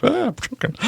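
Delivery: choking with laughter; squeaky laugh-choke; wheezing through giggles. Real, not acted. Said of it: "I'm like".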